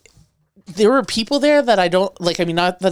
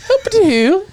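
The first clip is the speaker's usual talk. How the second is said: silly whoop; 13 semitones higher, 5 dB louder, slower than usual. Pretend. Silly exclamation.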